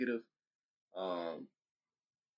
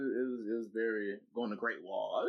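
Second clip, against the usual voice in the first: ordinary delivery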